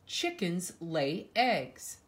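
usual speaking voice

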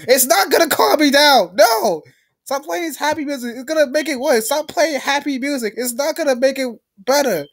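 high, agitated protest; angry rant. Real, not acted. Gamer raging at the game's happy music, high angry rant. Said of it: "I am really angry right now".

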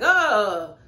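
high, loud mocking whine of Tyler; mock whiny voice with a fake cry and laugh. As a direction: sneer it like a whiny boyfriend. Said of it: "Tyler had to do something like".

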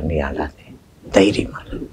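hushed, near whisper; tenderly calm a crying listener; soft reassurance. Acted. Preacher speaking God's comfort to a weeping listener, hushed.

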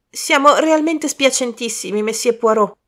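high, anxious apology; acted apprehension; apprehensive. A narrator's reading. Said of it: "con apprensione nella voce".

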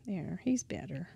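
whisper. Real, not acted. Fixes the bent robot.

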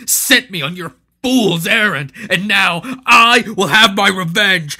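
builds to a shout, last line slowed and crying; vengeful cry. Acted. Maul vowing revenge on Hanno, building to a crying shout. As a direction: swear revenge, voice breaking at the end.

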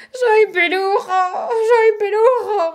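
the wizard howling as the pimple grows on his tongue; crying shout. Acted. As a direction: wail it out in panic. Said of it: "gritó".

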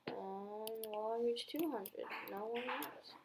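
usual speaking voice

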